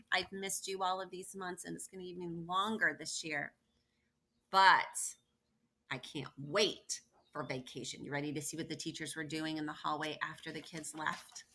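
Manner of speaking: usual speaking voice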